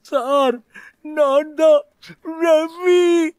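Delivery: a frightened student revealing who he is; anxious boy. Acted. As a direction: force the name out, panicked. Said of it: "அந்த சிறுவன் பதட்டத்துடன்".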